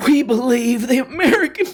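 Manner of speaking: high, loud, laughing through a fake sob; mock tearful reading. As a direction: read it welling up and crack up. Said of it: "Tim Pool reading this".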